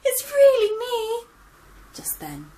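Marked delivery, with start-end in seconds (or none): 0.0-1.3 high, loud, crying insistence; wailing. Acted. Gran insisting to the piglets that it's her, crying. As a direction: sob it out up high. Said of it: "Gran wailed".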